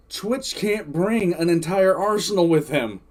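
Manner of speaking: usual speaking voice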